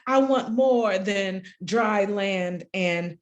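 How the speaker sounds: measured spoken-word cadence with lifted, falling phrases; solemn, deliberate poem recital; poetry reading. Acted. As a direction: let each image land, pausing between lines. Reads own poem aloud.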